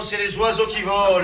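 ordinary talk, nothing marked

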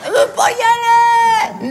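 high, whiny wail of protest; hammed-up tearful refusal. Refusing to climb the crane.

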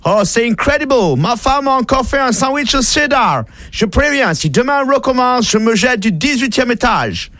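English worker moaning in a mock English accent. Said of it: "l'Anglais, prend sa gamelle et dit".

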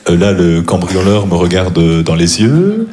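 deadpan storyteller stretching each last word upward; halting delivery with long rising tails on final words; stand-up comedian imitation. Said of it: "je vais faire des imitations".

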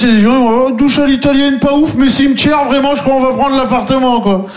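squeaky voice of a thrilled couple; high mock couple voice. Scene couple gushing about the cemetery view, high squeaky mock voice. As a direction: lift it way up, a giddy couple sold on the flat.

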